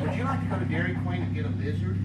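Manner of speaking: loud, laughing invitation. Retold or imitated. Grandpa laughing as he offers granddaughter a treat, loud. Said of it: "I said".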